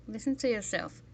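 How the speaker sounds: ordinary talk, nothing marked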